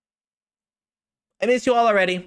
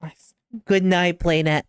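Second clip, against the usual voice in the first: ordinary talk, nothing marked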